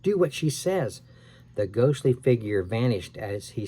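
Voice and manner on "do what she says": scared plea. A narrator's reading. Sam pleads, scared.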